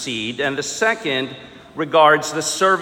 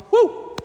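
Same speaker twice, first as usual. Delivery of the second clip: mock cheer.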